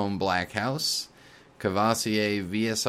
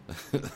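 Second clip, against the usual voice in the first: short rising burst of real laughter; helpless laugh at the story's end; 6 semitones higher, 4 dB quieter than usual. Real, not acted. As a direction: break into a quick real laugh. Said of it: "I'm sorry I'm laughing so much".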